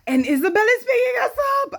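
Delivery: very high, loud squeal of news; excited shout. Real, not acted. Announcing that Isabel is coming, excited shout. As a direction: shriek it out with delight.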